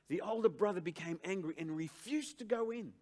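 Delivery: pulpit-pounding reread of scripture; preaching at full force. Acted. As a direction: declare the verse to the congregation. Verse repeated at full force.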